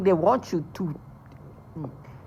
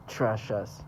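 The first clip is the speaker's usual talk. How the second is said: ordinary delivery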